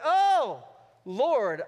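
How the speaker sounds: loud burst of Oh; sudden realization. Retold or imitated. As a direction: cry out as it suddenly dawns on you. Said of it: "I'm like".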